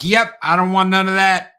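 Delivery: high, loud mock bail-out; acted nervous retreat. Film fan voices two guys facing the growling hero, backing off high and loud. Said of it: "And they're like".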